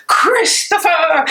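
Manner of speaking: playful retold yelling of a baby name; mock yell. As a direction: call the name out slowly like an insult. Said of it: "I would yell".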